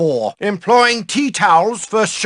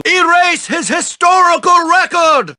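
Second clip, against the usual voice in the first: higher, exclaiming shout; ranting shout; 6 semitones higher than usual. Acted. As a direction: rail against the boy's record. Teacher rants at a student.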